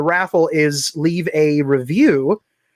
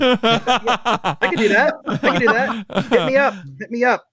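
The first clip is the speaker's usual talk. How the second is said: announcer guy; 5 semitones higher than usual. Pretend. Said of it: "announcer guy".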